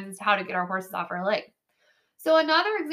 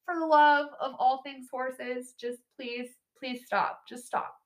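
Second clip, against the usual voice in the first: exasperated plea; 4 semitones higher, 5 dB louder, slower than usual. Retold or imitated. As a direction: beg the rider to stop, exasperated and fading. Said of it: "And I'm like".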